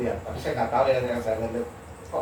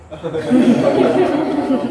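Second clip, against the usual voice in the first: high, loud burst of laughter; 10 semitones higher, 10 dB louder than usual. Real, not acted. Lecturer joking about teachers deserving heaven, laughing loudly. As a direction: laugh out loud at your own joke. Laughs at his own joke.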